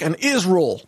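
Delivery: hammed-up Southern twang, swallowing Israel; Lindsey Graham. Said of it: "people like Lindsey Graham, they're like".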